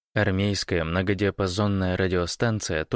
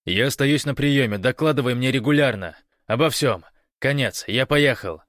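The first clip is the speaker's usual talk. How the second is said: raised, louder, firm command; radio orders; 5 semitones higher, 4 dB louder than usual. A narrator's reading. Гош tells comrade to report regularly, firm and commanding.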